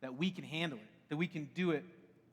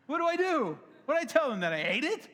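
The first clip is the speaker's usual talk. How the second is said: higher, louder retold panic; panicked; 10 semitones higher, 6 dB louder than usual. Father caught out over the eaten biscuit, panicked. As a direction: blurt it out in mock alarm. Acting out his panicked moment.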